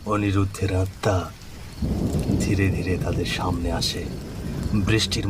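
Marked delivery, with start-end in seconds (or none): none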